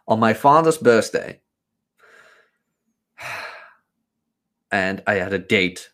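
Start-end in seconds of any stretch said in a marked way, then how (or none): none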